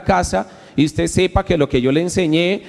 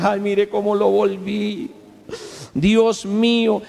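mock remorse; 4 semitones higher, slower than usual. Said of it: "con un cargo de conciencia".

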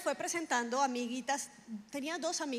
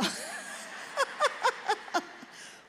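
long high laugh; helpless high peals of laughter; 12 semitones higher than usual. Real, not acted. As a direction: burst out laughing mid-sentence. Laughs mid-quote at her own joke.

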